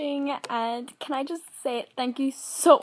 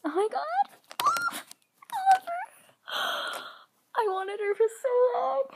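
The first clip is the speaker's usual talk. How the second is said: much higher, squealing with delight; excited squeal; 13 semitones higher than usual. Real, not acted. Finally getting the toy she wanted, high squeals.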